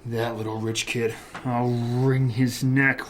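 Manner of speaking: soft, slow, seething threat; simmering quietly, drawing out the threat. Acted. Detective fuming after the interrogation.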